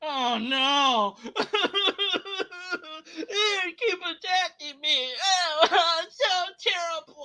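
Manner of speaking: high, mocking fake sobs and laughs; mock lament. Mocking the creator's complaint, fake crying. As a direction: pretend to weep at being attacked.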